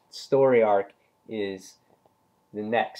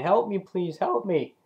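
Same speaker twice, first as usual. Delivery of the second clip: mock pleading, much higher; a retold guard hanging off a train, crying for help; higher, imitated desperate plea; guard begging; 8 semitones higher than usual. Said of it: "and he's saying".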